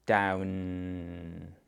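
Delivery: "Down" drawn out with the final n held; emphasized ending. Shown as a demonstration. Parent emphasizing the last sound of "Down" for a child. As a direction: stretch "Down" so the last sound rings. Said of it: "emphasize it".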